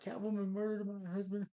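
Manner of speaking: high-pitched, hammed-up cry of accusation; mock accusing wife. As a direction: act out a widow's put-on alarm, far above your own voice. Acting out wife framing Catwoman.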